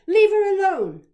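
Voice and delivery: very loud, high-pitched shout; full-voiced protective yell. A narrator's reading. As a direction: yell at a bully to back off. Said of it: "I shouted at Charlie".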